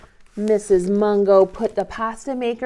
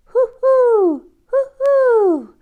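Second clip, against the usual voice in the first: high, loud owl hoo-hoo; owl hoot; 12 semitones higher, 9 dB louder, much slower than usual. Shown as a demonstration. Teacher to children, hooting like an owl. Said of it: "What do owls say".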